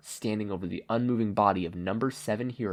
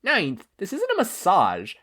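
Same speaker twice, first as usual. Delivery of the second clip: slow, higher, firm scold; 10 semitones higher, 5 dB louder, slower than usual. Acted. Nana scolding the distracted Izuku, slow and firm. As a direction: Nana reins him in, slow and stern. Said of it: "Nana scolded".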